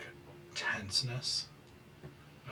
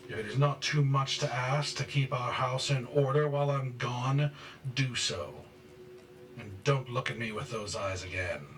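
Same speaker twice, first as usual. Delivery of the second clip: raised, domineering orders; angry scolding; 5 semitones higher, 5 dB louder than usual. Acted. Father orders mother to keep house, angry scolding. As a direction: lay down orders with simmering rage.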